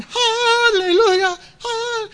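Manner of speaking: high, slow, joyful praise cry; imitating a jubilant hallelujah; mock hallelujah shout. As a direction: belt it like a revival, then cut off. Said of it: "You would think he'd be going".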